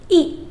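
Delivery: ई said as a short clipped i; teaching the short i sound of ई; short i sound demo. Shown as a demonstration. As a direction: sound out ई as a brief i, not a long ee. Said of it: "आई का short sound ई".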